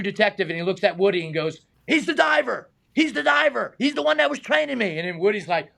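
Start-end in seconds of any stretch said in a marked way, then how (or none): 1.9-5.0 high-pitched, excited announcing; imitated excited recognition. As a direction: act out an actor thrilled to point someone out. Retold excited recognition of the speaker.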